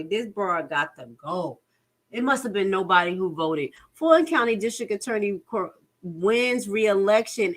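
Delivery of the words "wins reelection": ordinary talk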